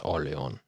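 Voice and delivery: "Orleon" said the French way, S left silent; low, soft "Orleon" with a nasal French ending; French pronunciation. Shown as a demonstration. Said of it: "pronounce it like it's French".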